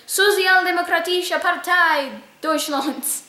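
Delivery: Sozialdemokratische Partei Deutschlands said in a self-conscious attempt at a German accent. Shown as a demonstration. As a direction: try your best German accent on the party name. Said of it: "if I have my German accent there".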